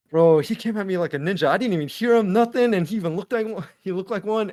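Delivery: loud, high, worked-up retelling; shaken, amazed account. Retold or imitated. Friend telling the others about being crept up on, shaken amazement. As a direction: imitate a spooked friend exclaiming in disbelief.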